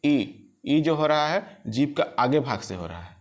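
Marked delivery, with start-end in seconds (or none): 0.0-0.1 a drawn-out model ई for students; vowel demo. Shown as a demonstration. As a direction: hold the sound ई as a long, clear ee vowel. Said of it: "उच्चारण करके देखिए".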